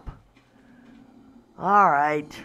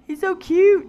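high, louder gushing squeal; cute squeal; 13 semitones higher, 8 dB louder than usual. Real, not acted. Player gushing over the pterodon, high squeal. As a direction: squeal like seeing a puppy.

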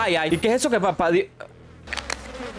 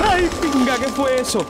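startled curse; 8 semitones higher than usual. Real, not acted. Scare in the game.

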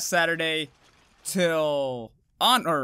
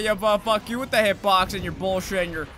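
ordinary talk, nothing marked

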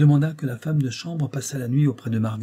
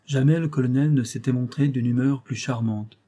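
usual speaking voice

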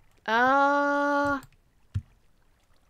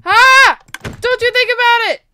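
high, loud startled yell; scream, then shout a warning at the attacker; panicked, high-pitched warning; alarmed shout; 8 semitones higher, 11 dB louder than usual. Real, not acted. Warding off an attacker.

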